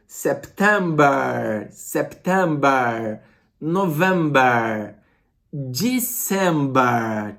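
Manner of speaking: September, November, December ditos devagar à inglesa, final em -ba; pronúncia inglesa lenta. Shown as a demonstration. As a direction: modele bem devagar cada mês em inglês. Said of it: "escutem bastante os áudios do professor Johnny". (professor dita os meses em inglês, bem devagar)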